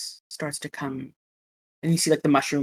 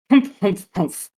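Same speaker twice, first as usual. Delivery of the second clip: grumpy humph; 3 semitones higher, 5 dB louder than usual. Retold or imitated. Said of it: "make the noise as well".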